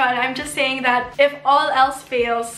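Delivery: ordinary talk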